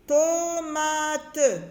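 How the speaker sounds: "tomate" very slow, every syllable stretched. Shown as a demonstration. Teacher helping children hear the syllables, "tomate" stretched slowly. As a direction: draw out each syllable of "tomate". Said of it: "La syllabe to".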